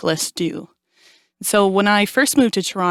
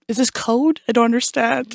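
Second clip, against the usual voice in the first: higher, puzzled mock aside; baffled; 4 semitones higher than usual. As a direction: relive being totally lost in class. Acting out her baffled first reaction.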